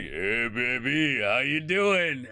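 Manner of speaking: slow, drawn-out vision of a Buddha; golden Buddha. Acted. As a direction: stretch it into a long, swooping greeting. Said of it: "very triangular golden Buddha".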